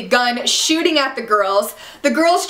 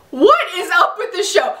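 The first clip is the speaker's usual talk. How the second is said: ordinary talk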